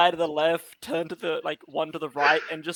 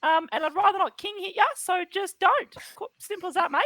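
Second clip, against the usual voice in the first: fast, higher Australian bogan voice; sassy bogan; 11 semitones higher, faster than usual. Acted. Tree dropping its act in a fast Australian bogan voice. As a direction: rattle it off like a cheeky Aussie bloke. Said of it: "Why does he sound like a sassy bogan?".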